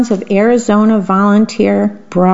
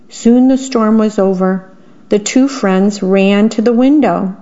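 ordinary delivery

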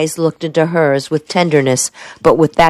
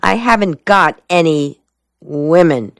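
usual speaking voice

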